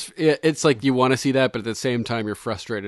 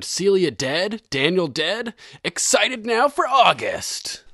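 hyped up; 7 semitones higher, slower than usual. Acted. Reading an excited listener comment.

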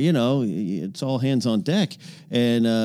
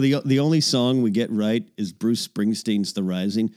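ordinary talk

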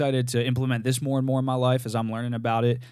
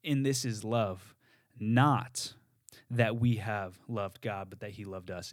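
"Not" set apart by a pause, then "that we have loved God" slow; slow meditative reading, pause after "Not"; pausing on NOT; slower than usual. Shown as a demonstration. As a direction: stop after "Not" and let it hang. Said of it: "it would sound something like this".

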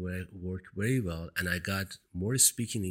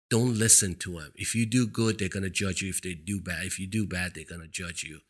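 ordinary talk

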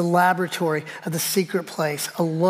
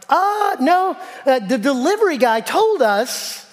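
higher-pitched, drawn-out objection; protesting; 6 semitones higher than usual. Retold or imitated. Customer objects to a no-returns policy, protesting. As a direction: push back in a high, insistent voice. Acted protest to store clerk.

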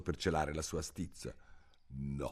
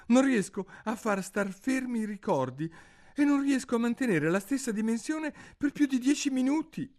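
put-on much higher girlish voice, a bit louder; girl Alice; 5 dB louder, faster than usual. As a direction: complain like a flustered little girl. (Alice bambina, voce alta e agitata)

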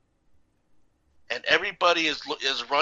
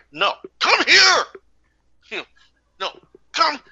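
insistent call; 13 semitones higher, 7 dB louder than usual. Retold or imitated. Teacher insisting the student come over, high loud call.

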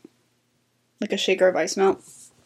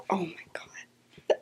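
slow, breathless stunned whisper; shocked whisper; 4 semitones lower, 14 dB quieter, much slower than usual. Retold or imitated. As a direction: whisper it, floored by what she heard. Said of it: "I was like".

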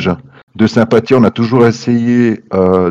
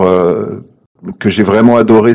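usual speaking voice

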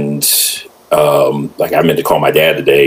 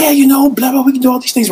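much higher, mock salesman babble; eager contractor patter; faster than usual. Contractor pitching extra work to homeowners, imitated eager patter.

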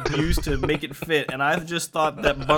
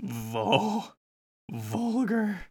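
soft, higher-pitched questioning echo; taken aback; 6 semitones higher, 3 dB quieter, much slower than usual. Real, not acted. Game master questioning a player's odd spell adjective, taken aback. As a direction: repeat the word softly, rising in disbelief.

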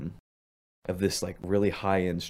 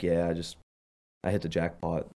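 imitating a stunned winner, lower and softer; unexcited deadpan; 4 semitones lower, 5 dB quieter than usual. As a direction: drop the big news quietly, without excitement. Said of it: "same demeanor".

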